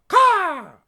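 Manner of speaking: high, loud raven squawk; sharp bird call; raven caw. Acted. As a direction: caw like a crow. Raven Anna agrees.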